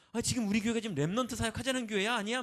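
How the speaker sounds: 빠르고 높게 튀어나오는 성난 투덜거림; 행정에 화가 나서 언성을 높이듯. Retold or imitated. Church staff irritation, retold outburst. (재정 쓰임에 불만인 목사가 속으로 내지르는, 빠르고 높은 성난 투정)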